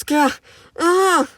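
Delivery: sharp high yelps of pain; hammed-up cries of pain. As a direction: cry out as the arm snaps. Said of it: "I scream in pain as my arm snaps".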